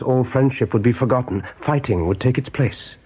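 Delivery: ordinary delivery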